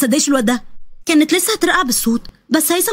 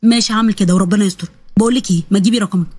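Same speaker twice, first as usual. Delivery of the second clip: low male character voice; young man agreeing; 7 semitones lower than usual. Acted. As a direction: ask for her number, voice lowered. (شاب بيطلب رقمها بصوت واطي)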